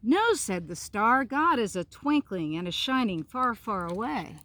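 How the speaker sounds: put-on slow, lilting storybook reading for children; story narration. A narrator's reading. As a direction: read it like a bedtime tale, savouring each line. Reads picture book aloud.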